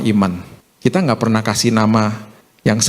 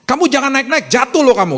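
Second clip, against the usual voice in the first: high-pitched threatening warning; mock harsh telling-off, pitched way up; acted parental scolding; 14 semitones higher than usual. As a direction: scold a climbing child as if cursing them. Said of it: "Kita nyumpahin dia".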